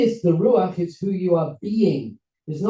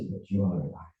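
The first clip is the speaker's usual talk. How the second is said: very low, hushed lion voice; grave, deep lion revelation; Lion King; 10 semitones lower, 8 dB quieter than usual. Acted. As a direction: drop deep like a father lion. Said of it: "And the lion says".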